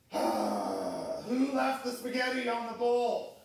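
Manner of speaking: mock exasperated about the bowl; mock annoyed outburst. Dad at the sink griping at family, mock annoyed.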